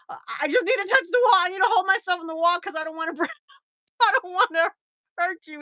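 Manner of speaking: fast, high retold plea; panicked pleading. Patient reaching for the wall explains to therapist, frantic pleading. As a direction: rush it out high and urgent.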